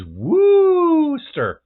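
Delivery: slow, loud, drawn-out spooky announcement; slow spooky drawl. Acted. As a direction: stretch the name out spookily.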